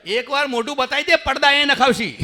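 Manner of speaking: husband teasing wife about the curtains, loud and laughing. Retold or imitated. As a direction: land the joke loud and high. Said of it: "ગાંડી થઈ છે".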